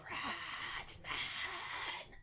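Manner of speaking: eerie breathy whisper; creepy whisper. Retold or imitated. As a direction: hiss it like a haunted man. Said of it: "he just keeps saying".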